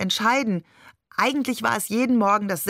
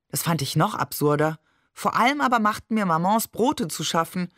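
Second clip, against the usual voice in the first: usual speaking voice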